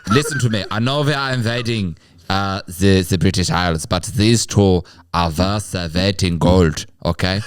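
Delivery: mock German officer voice, slowing on the promise; lightly accented Nazi commander reassuring his men; measured German officer. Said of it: "he goes".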